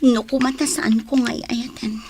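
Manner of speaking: ordinary talk, nothing marked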